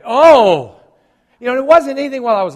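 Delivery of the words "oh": loud, higher groan of sore muscles; pained groan. Retold or imitated. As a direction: groan as sore legs wake up. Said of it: "I went".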